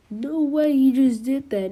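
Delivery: shocked exclaim. Retold or imitated. Examinees watching Deku, loud stunned disbelief. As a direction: blurt it out, floored. Crowd shocked at exam site.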